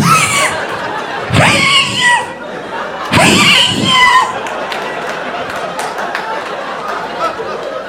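high, squealing laugh; long laugh. Real, not acted. Laughing at choking dog.

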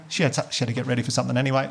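ordinary talk

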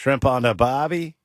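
short mock Aussie accent; Australian accent. Co-host asked for an Australian accent, trying it lightly. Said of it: "Give me your Australian accent".

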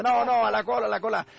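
high, loud queue protest; mock indignant crowd yell; line-jumper rebuke. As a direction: shout the queue-jumper back. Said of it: "se regresa y le dice".

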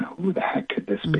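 softly whispered puzzlement; whispered thought. Retold or imitated. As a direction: wonder to yourself in a hushed whisper. Puzzled thought at night knock.

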